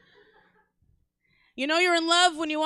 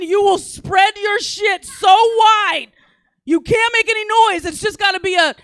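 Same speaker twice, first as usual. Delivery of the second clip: loud, high, emphatic outburst; high-pitched comic rant at full volume; worked-up rant; 6 semitones higher, 8 dB louder than usual. Real, not acted. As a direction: shout it out to the whole room. Worked-up rant about bathroom noise.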